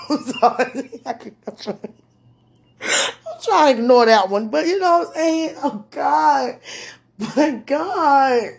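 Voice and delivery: high, loud, helpless giggly pleading; flustered laughter. Real, not acted. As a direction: fight the giggles and cry out to God. Flustered, trying not to laugh.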